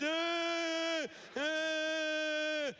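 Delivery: long high sustained wail; hammed-up high crying drone; mock hairdryer wail. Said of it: "she's holding her hairdryer, just".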